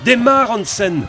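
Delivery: high-pitched urgent yell; shouted command in a crisis; yelled order. Acted. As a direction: yell for the engine to be started right now. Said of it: "hurla Valfred".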